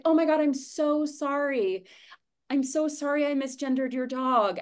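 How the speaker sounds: high-pitched, gushing mock apology; sweetly apologetic. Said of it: "they get so sweetly apologetic".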